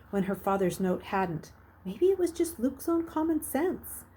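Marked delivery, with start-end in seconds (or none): none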